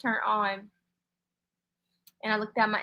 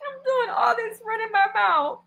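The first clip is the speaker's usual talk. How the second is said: crying out; 12 semitones higher than usual. Real, not acted. Streamer overwhelmed on live.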